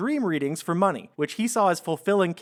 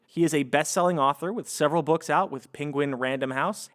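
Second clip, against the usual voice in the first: ordinary talk, nothing marked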